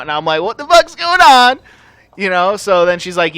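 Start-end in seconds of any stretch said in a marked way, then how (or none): 0.4-1.6 high, loud alarmed question; panicked. Retold or imitated. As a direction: ask it in rising panic. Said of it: "I'm freaking out".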